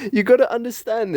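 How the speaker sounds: ordinary delivery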